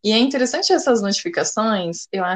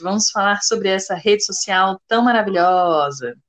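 ordinary talk, nothing marked

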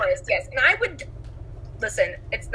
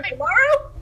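excited prince; 9 semitones higher than usual. Retold or imitated. Prince excited to meet again.

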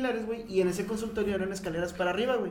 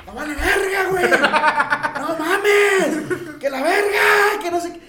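high, loud frantic cursing; panicked rant; 9 semitones higher, 9 dB louder, much slower than usual. Retold or imitated. Friend panicking on the stairs, high and loud. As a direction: wail it out, losing it.

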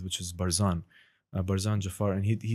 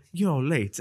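Gandalf; 6 semitones higher, 4 dB louder than usual. Shown as a demonstration. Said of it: "a bit of Gandalf".

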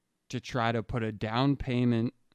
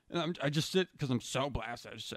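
usual speaking voice

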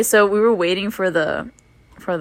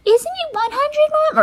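put-on little girl; 4 dB louder than usual. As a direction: pipe up like a small daughter. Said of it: "her daughter was like".

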